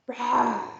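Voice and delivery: drawn-out play lion roar. Pretend. Storyteller plays the lion for young listeners, drawn-out roar.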